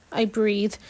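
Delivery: usual speaking voice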